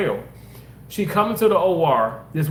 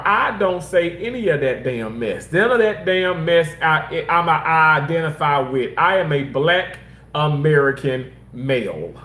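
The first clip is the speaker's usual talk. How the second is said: ordinary delivery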